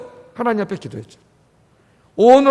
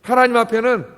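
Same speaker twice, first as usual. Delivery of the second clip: normal talking voice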